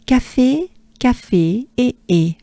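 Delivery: café ending in a pure é; French café E. Shown as a demonstration. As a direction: model café, then isolate its final E. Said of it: "Think of café".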